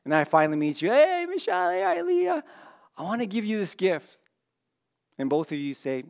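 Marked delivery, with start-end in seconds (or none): 0.9-4.2 very high, sing-out hello; excited greeting. Acted. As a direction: call out to friends in a high voice. Acts greeting friends with a gift.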